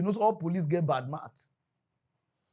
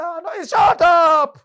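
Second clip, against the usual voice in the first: slow, much higher and louder shout; shouting cop; 14 semitones higher, 14 dB louder, much slower than usual. Retold or imitated. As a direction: drag out shut up like a furious officer. Officer shouting.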